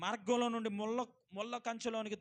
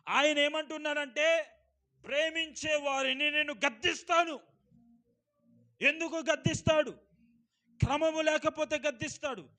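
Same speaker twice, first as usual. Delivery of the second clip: loud, ringing pulpit voice; fervent sermon delivery, raised and loud; preaching at full force; 7 semitones higher, 9 dB louder than usual. Acted. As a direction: preach it out to the whole hall. Preacher repeats why God rebukes.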